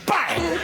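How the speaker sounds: sudden high-pitched "bang!"; imitated impact bang. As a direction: pop it out like a sudden hit.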